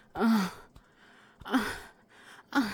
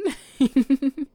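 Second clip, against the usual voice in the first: high, loud giggle of delight; teasing laugh; 8 semitones higher, 10 dB louder than usual. Acted. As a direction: burst into a bright teasing giggle.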